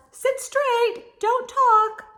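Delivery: hammed-up strict teacher; stern teacher. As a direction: bark the class into order. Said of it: "telling the class".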